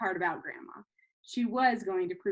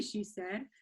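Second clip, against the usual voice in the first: ordinary delivery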